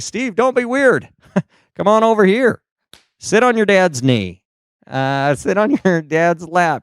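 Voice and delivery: high-pitched, hammed-up dad voice; coaxing dad. Dad coaxing his son onto knee.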